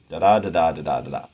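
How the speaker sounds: quick "da da da da" chant, every second one stressed; iambic beat. Shown as a demonstration. Teacher scanning the last few feet, iambic beat. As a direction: rattle off "da da da da" as da-DA, da-DA. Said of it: "are just regular iambs".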